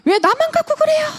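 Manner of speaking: high, loud indignant whine; angry complaint. Retold or imitated. Student snapping back at teachers, high and loud in indignation. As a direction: protest like a kid who feels picked on. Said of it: "화를 내면서 뭐라고 하냐면".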